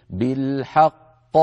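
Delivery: بالحق slowly, qafs joined, with the last qaf bouncing; correct reading. Shown as a demonstration. Teacher closing with the correct بالحق. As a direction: say بالحق drawn out, with no gap. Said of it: "إذن الصواب أن نقول".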